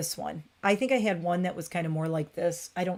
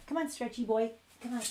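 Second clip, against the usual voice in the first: coaxing pet; 4 semitones higher, 6 dB quieter, faster than usual. Real, not acted. Coaxing the cat.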